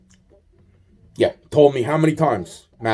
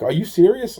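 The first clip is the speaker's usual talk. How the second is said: higher, incredulous question; retelling his stunned disbelief, pitched up; 8 semitones higher than usual. Retold or imitated. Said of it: "I'm like".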